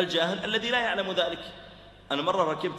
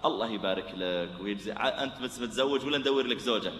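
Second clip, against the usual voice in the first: soft, much lower friendly chat; gentle friendly talk; 8 semitones lower, 4 dB quieter than usual. Acted. Preacher soothing an angry shopkeeper with soft, low friendly talk. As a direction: win him over softly. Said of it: "فلو جيت قلت".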